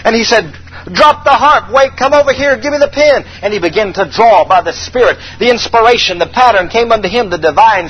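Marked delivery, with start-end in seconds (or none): 0.9-3.2 higher, quick urgent orders; David suddenly urgent; urgent command. Acted. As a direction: call out, seized by inspiration. David urgent under Spirit.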